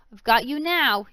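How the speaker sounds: loud gloating yell. Acted. Loxy catching Amy's arm, loud gloating yell. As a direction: shout it as you catch her. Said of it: "he yelled".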